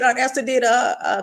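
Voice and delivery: normal talking voice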